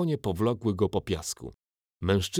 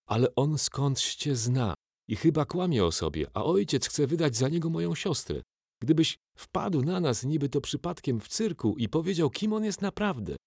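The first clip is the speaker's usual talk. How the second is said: put-on higher, hesitant boy's voice; a nervous boy asking a big man a favour; timid boy; 8 semitones higher than usual. A narrator's reading. Marek explains his plan.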